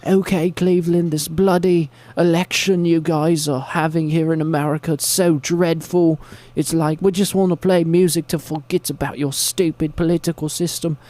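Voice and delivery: high-pitched mock British rant; British accent. British band addressing Cleveland about election, mock British accent. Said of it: "then they're British, and they're like".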